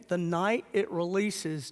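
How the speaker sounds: usual speaking voice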